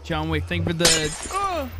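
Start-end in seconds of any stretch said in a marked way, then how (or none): 1.3-1.7 sudden high startled yelp. Real, not acted. Thanking John Wick when something scares him, startled. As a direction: jump in fright, pitch shooting up. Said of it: "Scared me".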